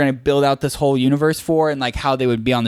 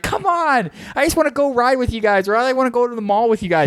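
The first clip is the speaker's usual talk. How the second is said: put-on very high whining kid, faster at the end; whiny little cousin; 10 semitones higher than usual. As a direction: whine like a kid left behind. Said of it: "And they're like".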